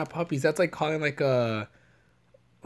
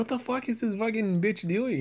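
scared reaction; 7 semitones higher, 5 dB quieter than usual. Retold or imitated. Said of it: "I was scared".

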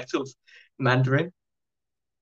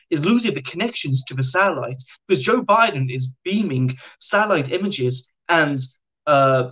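higher, sing-song imitation of a rambler; delusional inmate; 4 semitones higher than usual. Quoting the inmate's delusions to the host, animated mimicry. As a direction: imitate an earnest, rambling boaster with swooping pitch.

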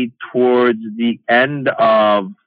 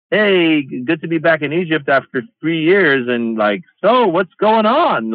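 cheery, higher-pitched play at knowing nothing; breezy greeting, innocently oblivious, rising high on the question; feigned innocence; 5 semitones higher than usual. Shown as a demonstration. Comedian greets an Egyptian crowd, alluding to upheaval, feigned innocence. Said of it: "acting like I don't know".

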